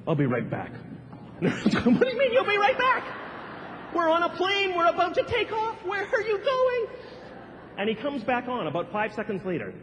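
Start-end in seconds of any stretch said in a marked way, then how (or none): 0.1-0.7 droning low captain voice; flat lazy pilot on the PA; monotone pilot. Retold or imitated. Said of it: "he sounds very, you know, monotone at first". 1.4-6.9 alarmed passenger. Acted.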